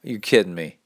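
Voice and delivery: ordinary talk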